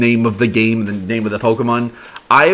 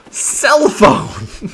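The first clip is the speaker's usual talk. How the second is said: laughing; 7 semitones higher than usual. Real, not acted. Joking about phone item.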